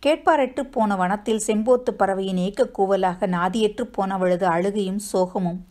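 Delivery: ordinary delivery, nothing marked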